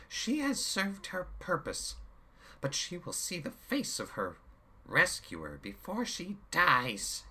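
slow, high gloating villain; menacing villain. A narrator's reading. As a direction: savor the cruelty. Chaos continues.

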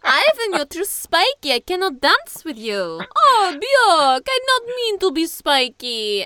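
loud, high mock European accent; hammed-up European-accented child characters bickering; vaguely European accent. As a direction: put on a big, silly European accent. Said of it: "I give them vaguely European sounding accents".

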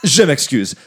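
put-on loud hammy French accent; french chef. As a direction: play a pompous French prince loudly. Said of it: "Begins to talk loudly".

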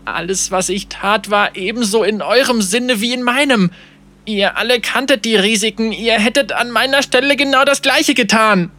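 high, desperate, quavering self-defence; trembling protest. Acted. Captain defending himself to his dead crew, trembling protest. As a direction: plead your case in rising panic. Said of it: "mit zitternder Stimme".

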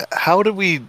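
ordinary delivery

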